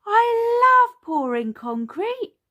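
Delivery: put-on very high, loud child's shout; excited shout. A narrator's reading. Peppa to Daddy while pouring concrete, excited shout. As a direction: belt it out high with delight. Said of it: "shouted Peppa".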